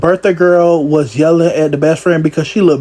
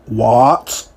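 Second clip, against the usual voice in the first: normal talking voice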